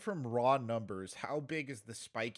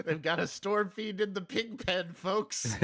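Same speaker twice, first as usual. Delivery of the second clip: chuckling while repeating the line, a touch higher and louder; laughing through it; 6 semitones higher, 4 dB louder than usual. Real, not acted. Caster repeating his co-caster's odd sentence, laughing. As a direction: let a real chuckle carry the words. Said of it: "I'm just having a private chuckle".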